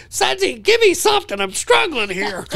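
high-pitched imitation of a player yelling for help; retold plea in a high, strained yell; pleading call-out. Acting out Cameron calling for help.

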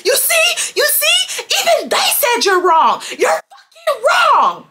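high screechy yell, imitating an opponent; shrieked, gloating imitation; mock yelling. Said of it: "like, to yell and say".

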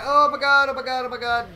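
loud, sharp cry of panic; alarmed yell. Real, not acted. Streamer yelling as the car slides. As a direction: blurt it out in a fright. Police chase going wrong.

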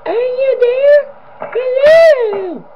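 very high, slow eerie animatronic wail; high-pitched creepy voice. Retold or imitated. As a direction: stretch it out in a piercing high ghostly call. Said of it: "Poe sounds like this".

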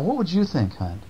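ordinary delivery

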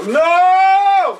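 ordinary talk, nothing marked

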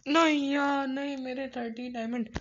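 loud, high cry of dismay; real panicked yell, easing at the end; anguished cry. Real, not acted. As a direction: wail it out as all is lost. Dying in game, losing diamonds.

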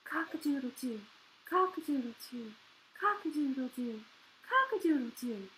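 low, soft, repeated weak crows; sore-throat rooster. A narrator's reading. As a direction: keep trying to crow with little voice. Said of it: "The rooster tried again".

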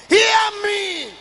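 loud, high, drawn-out plea; impassioned prayer from the pulpit; fervent prayer. Real, not acted. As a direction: cry out to God. Congregation told to make it prayer.

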